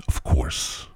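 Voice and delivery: breathy whispered aside after the boast. Pretend. Host whispers a smug aside to listeners. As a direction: drop to a whisper for the punchline.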